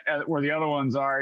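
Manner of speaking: usual speaking voice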